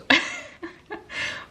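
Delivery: high burst of self-mocking laughter; laughing at herself. Real, not acted. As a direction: laugh at your own accent. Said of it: "Je rigole".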